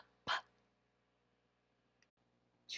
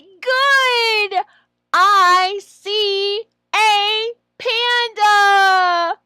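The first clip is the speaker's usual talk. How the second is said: high, very slow, loud full sentence; praise, then model the whole sentence slowly; teacher praise; 10 semitones higher, 13 dB louder, much slower than usual. Shown as a demonstration. Trainer models a complete-sentence reply, slow and loud.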